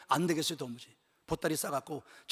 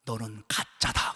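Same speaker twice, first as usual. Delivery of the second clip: slow, low solemn verdict; voice of God; 9 semitones lower, much slower than usual. Retold or imitated. God telling the pastor he is fake, slow and low. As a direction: pronounce it slowly like God speaking. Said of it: "나한테 이렇게 말씀합니다".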